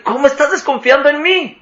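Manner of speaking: muy agudo, ofendido y sin creerlo; indignación incrédula. Retold or imitated. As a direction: reclamar herido por la desconfianza. Indignado porque desconfían de él. (reclamo agudo e incrédulo al que desconfía de él)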